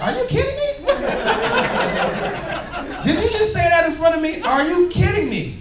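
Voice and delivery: high, laughing "are you kidding me"; amazed disbelief. Retold or imitated. Retelling the bartender moment with laughing, high-pitched amazement. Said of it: "I was like".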